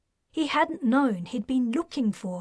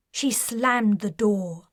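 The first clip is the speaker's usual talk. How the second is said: plain reading voice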